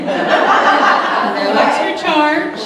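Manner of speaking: slow, high and loud through laughter; laughing punchline. Real, not acted. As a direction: drag it out laughing at the joke. Joke about free bugs.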